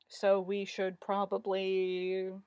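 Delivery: slow, drawn-out hesitation; trailing off. A narrator's reading. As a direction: stretch it out, left hanging unfinished. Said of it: "Jeffrey trailed off".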